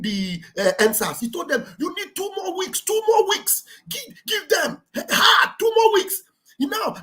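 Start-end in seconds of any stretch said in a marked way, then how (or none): 1.8-6.2 high-pitched insistent pleading; urgent imitated demand. Acting out pressuring them for time.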